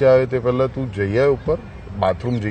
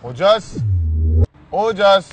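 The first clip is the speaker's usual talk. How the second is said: calling the name, higher and a bit raised; 6 semitones higher, much slower than usual. Real, not acted. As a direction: call Ojas down from the street. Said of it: "મને બૂમ પાડે છે?".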